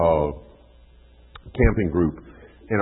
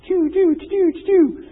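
much higher, pew-pew game noises; arcade game zaps; 6 dB louder, slower than usual. Retold or imitated. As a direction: zap like a kid lost in a video game. Kid playing arcade game.

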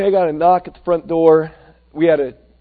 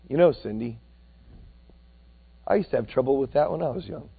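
gentle reassurance; 4 semitones lower, 6 dB quieter, faster than usual. Retold or imitated. Old man gently reassures Cindy.

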